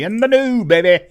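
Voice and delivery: over-the-top flirty brag; cheesy seductive tease. Acted. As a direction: sell it like a lounge lothario. Playful sexy tease.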